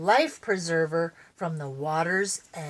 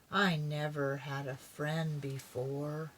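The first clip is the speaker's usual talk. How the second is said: much softer and lower, shy; shy soft words; 5 semitones lower, 10 dB quieter than usual. Acted. Buzz speaks shyly to Mark, very soft. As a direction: say it shyly, barely aloud.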